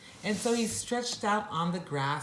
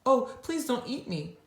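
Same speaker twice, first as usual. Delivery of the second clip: quivering in fear; 8 semitones higher than usual. Acted. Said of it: "all quivering in fear".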